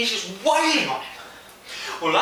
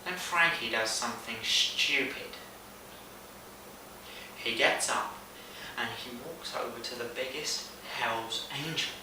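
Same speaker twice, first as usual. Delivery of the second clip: quiet, low-pitched storytelling with dread building; hushed suspense; 10 semitones lower, 12 dB quieter than usual. Acted. Friend telling of Frankie approaching the biggest Hell's Angel, hushed suspense. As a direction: drop low and soft for the ominous turn. Suspense before foolish move.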